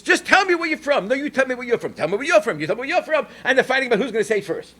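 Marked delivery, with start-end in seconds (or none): 0.0-3.3 mock bickering. Ladies bickering, voiced.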